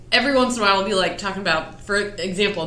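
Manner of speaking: normal talking voice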